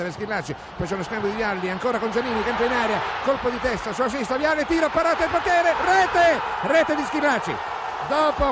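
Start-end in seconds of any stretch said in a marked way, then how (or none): 0.8-3.0 rising excited play-by-play, then goal yell; breathless italian goal commentary. Real, not acted. Live goal call rising to peak. 3.2-7.5 high, loud shouted goal call; goal scream. Real, not acted.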